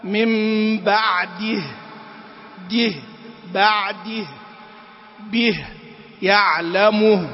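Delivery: slow "من بعده" ending in a clear -dih; firm vowel before stop. Shown as a demonstration. Teacher modelling correct stops, firm vowel before final letter. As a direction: hold a true i in "به", a true u in "يعلمه". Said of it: "عدم إحكام حركة الحرف قبل الأخير".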